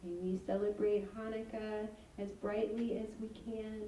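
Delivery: normal talking voice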